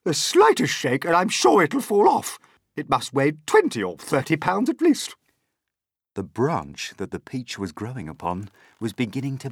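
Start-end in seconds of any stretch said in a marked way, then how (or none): 0.0-5.1 high, loud excited warning; alarmed yell. A narrator's reading. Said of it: "Aunt Spiker yelled".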